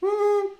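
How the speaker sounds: very high hoot; owl hoot. Shown as a demonstration. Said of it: "And then owls go".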